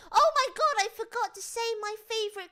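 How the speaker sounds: high, loud burst of realisation; real excited exclamation; sudden excited outburst. Real, not acted. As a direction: blurt it out, voice shooting up. Suddenly remembers her favourite film.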